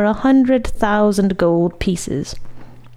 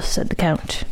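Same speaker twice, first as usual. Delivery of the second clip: usual speaking voice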